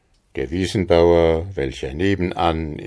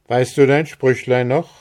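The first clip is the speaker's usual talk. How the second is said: put-on higher, louder young man's call; Andres calling out; 7 semitones higher, 6 dB louder than usual. A narrator's reading. Andres calls out to Maren on arrival, higher and louder. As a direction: call across to her, eager. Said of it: "rief er ihr entgegen".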